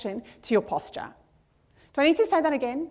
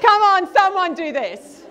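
urging crowd; 6 semitones higher, 5 dB louder than usual. Real, not acted. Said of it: "coaxing the audience to join".

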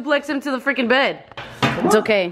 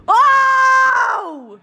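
surprised yelp; 10 semitones higher, 5 dB louder than usual. Real, not acted. Yelp during wrestling.

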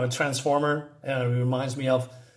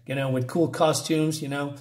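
ordinary talk

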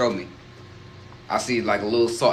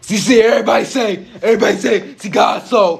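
high, loud laughing mock of his hollering; a teasing impression, much higher and louder while laughing; mocking uncle's loud preaching; 8 semitones higher, 5 dB louder than usual. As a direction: parrot his shouting, cracking up. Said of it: "look at you talking so hard".

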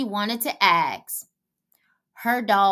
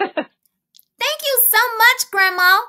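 cheering thanks; 11 semitones higher, 6 dB louder than usual. A narrator's reading. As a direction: cheer it like a delighted child. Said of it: "Lizzie cheered".

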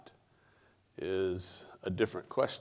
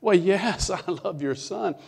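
high, loud, laughing reply; man answering God, high, loud and laughing; laughing yes; 10 semitones higher, 8 dB louder than usual. Retold or imitated.

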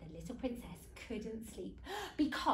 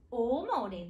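plain reading voice

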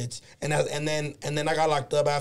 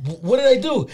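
high, loud confused protest; student protesting to the teacher, high and loud; indignant protest; 7 semitones higher, 6 dB louder than usual. Retold or imitated. Startled what did I do, higher and louder. His younger self protesting.

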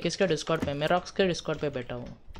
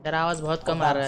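raised voice; 7 dB louder, faster than usual. Real, not acted. Gamer to teammate on Discord, louder and quick. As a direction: push it out fast over voice chat.

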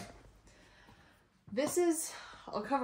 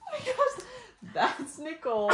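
amused shock; 10 semitones higher, 4 dB louder, much slower than usual. Real, not acted. Reacting to old yearbook photos.

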